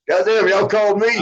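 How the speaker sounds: high mock disbelief, God damn thrown up high; surprised. Startled outburst at the President calling him himself. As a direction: act amazed that he really called you. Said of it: "I'm like".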